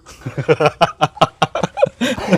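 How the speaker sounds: amused laugh at own joke. Real, not acted. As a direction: burst into laughing.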